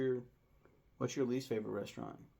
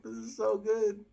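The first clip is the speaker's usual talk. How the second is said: much higher, slow gush of delight; high mock rapture; 11 semitones higher, 5 dB louder, slower than usual. Quoting himself eating the burger, a high slow swoon.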